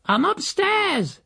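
very high, drawn-out call from afar; shrieky far-off answer, stretched out; housekeeper calling back. A narrator's reading. Housekeeper answers from upstairs, calling down irritably. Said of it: "came an answer".

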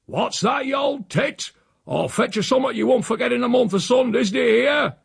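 high outraged hollering; angry threat. A narrator's reading. Uncle threatens the housekeeper upstairs, outraged shout. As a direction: bawl a threat up at someone.

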